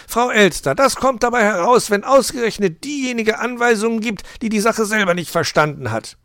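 loud, high scolding fox; indignant calling out. A narrator's reading. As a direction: tell her off loudly. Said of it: "rief Herr Fuchs".

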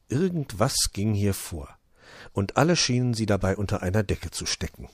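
ordinary delivery, nothing marked

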